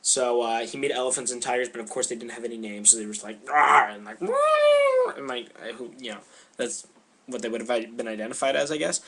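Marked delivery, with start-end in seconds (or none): 3.5-3.9 short, loud mock roar; imitated animal growl. Imitating unnamed animals' cries. 4.2-5.2 very high, loud imitated animal call; high-pitched mock beast cry. Imitating unnamed animals' cries.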